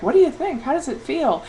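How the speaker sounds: high, eager mock questioning; imitating curious questioners. Surgeons quizzing a patient socially, imitated eager curiosity. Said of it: "they had all these questions".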